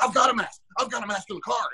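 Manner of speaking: mock-indignant insistence; imitating offended protest. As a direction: protest defensively, mimicking the accused man. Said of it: "real offended".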